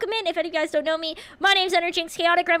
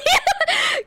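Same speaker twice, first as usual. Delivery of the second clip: high giggle; 3 dB louder than usual. Real, not acted. High laugh after joke.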